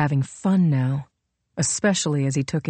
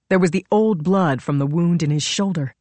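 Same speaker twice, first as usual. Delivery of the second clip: usual speaking voice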